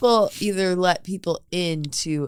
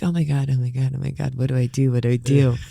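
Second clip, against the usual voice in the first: low panicked mutter; 9 semitones lower, faster than usual. Retold or imitated. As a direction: whisper it in mock panic. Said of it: "And I'm laying there being like".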